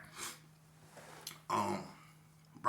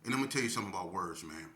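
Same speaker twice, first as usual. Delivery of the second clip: usual speaking voice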